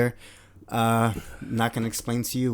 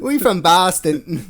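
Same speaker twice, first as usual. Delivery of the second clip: slow, loud mock Boston accent; bad Boston accent; 5 semitones higher, 6 dB louder, slower than usual. Co-host joining the host's bit, bad Boston accent. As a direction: stretch it out as a badly faked Bostonian. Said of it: "We're not even doing the accent".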